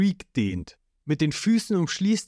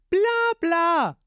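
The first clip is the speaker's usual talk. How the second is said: mocking Ruffy. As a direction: brush him off like a bratty kid.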